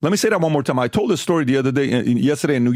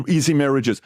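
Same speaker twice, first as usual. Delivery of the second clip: ordinary delivery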